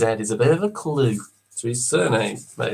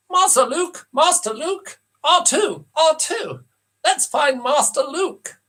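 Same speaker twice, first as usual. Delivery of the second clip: high, slow, fussy robot butler; prissy anxious droid calling out; 12 semitones higher, slower than usual. Acted. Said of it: "Who am I doing?".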